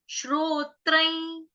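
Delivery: श्रोत्रैं stretched out slowly, syllable by syllable; slow recitation. Shown as a demonstration. Teacher modelling the word for students, slow. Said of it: "अब हम इसका उच्चारण देख लेंगे".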